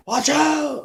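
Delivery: high panicked yelp; mock yell. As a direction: shriek it like a jumpy passenger. Passenger hollering again.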